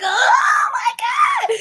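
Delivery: very high, loud, drawn-out squeal; hammed-up high-pitched laughing swoon. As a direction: gush like a smitten fan. Swoons over homeboy's soothing voice.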